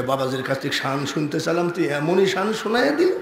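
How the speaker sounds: fast and soft, rising at the end; quick muttered aside. Acted. Preacher imagines the women judging his performance, quick soft aside. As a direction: rattle it off under the voice, ending on an upswing. Voices the women's private thoughts.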